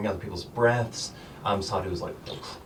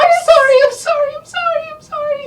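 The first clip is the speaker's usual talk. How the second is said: very high, fast, loud little gnome voice; a tiny girl babbling sorry over and over; frantic squeaky apology; 11 dB louder, faster than usual. Acted.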